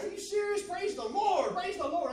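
loud, high, amazed rejoicing; elated shout. Retold or imitated. Man hearing she got a house offer, elated shouting. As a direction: whoop praise at the news. Acted reaction to good news.

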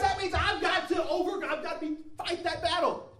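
high, loud, driving sermon delivery; impassioned pulpit shout; full-force preaching. Real, not acted. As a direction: preach it out at the top of your range.

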